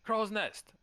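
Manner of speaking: loud, high-pitched call to a lookout; high sailor's hail; shout up high. Acted. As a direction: holler up to the crow's nest. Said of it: "he shouts out, shouts up to the crow's nest".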